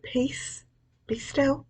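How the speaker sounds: soft, voiceless whisper; Jesus stilling the storm, whispered. Acted. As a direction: breathe the words as a calm whisper. Jesus' words quoted again.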